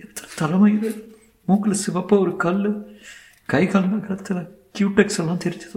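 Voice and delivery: high woman's voice, upset; distressed young woman. Acted. Young woman grieving aloud to two lawyers over the dead girl, distressed.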